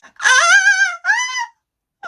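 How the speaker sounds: high, loud anguished wail; hysterical wailing. Acted. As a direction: wail in disbelief. Acted Big Brother grief scene.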